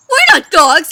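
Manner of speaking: high, very loud horse voice; emphatic protest. A narrator's reading. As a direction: exclaim it in outrage. Shocked horse protests loudly.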